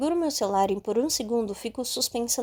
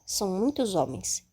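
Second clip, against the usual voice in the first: ordinary delivery, nothing marked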